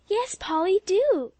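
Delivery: high excited plea; eager cry. A narrator's reading. Beg eagerly, pitched high. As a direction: girls begging Polly to tell it. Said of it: "cried the other girls".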